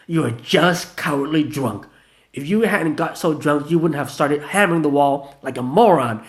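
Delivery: loud, slowed scornful insults; contemptuous tirade. Acted. Gina insulting drunk Vanilla, loud scornful. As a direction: lay into him.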